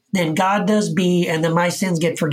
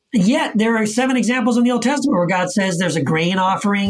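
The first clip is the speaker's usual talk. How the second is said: ordinary talk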